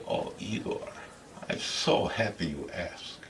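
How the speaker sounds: put-on soft, low, touched girlfriend voice; Igret. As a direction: murmur it softly to your sweetheart. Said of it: "And she say".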